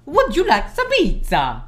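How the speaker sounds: slow, hammed-up Italian accent. Shown as a demonstration. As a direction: put on a big Italian accent to prove a point. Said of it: "it's just an Italian accent".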